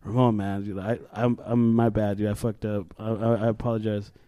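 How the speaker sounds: low, soft, halting apology; sheepish apology. Retold or imitated. Student to Ramon next period, sheepish apology. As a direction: apologize low and soft, stumbling over words.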